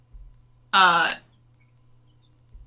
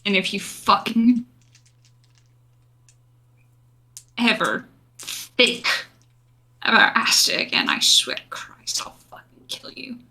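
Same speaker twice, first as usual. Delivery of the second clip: whispered threat. Acted. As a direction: threaten him in a deadly whisper. Menacing threat to villain.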